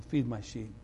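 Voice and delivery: Jesus softly telling Peter his task; soft command. Retold or imitated. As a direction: say it quietly, like a gentle charge.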